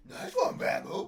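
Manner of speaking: high squeaky whisper; a squeaky sarcastic cheer; squeaky mock voice. Mocking cheer.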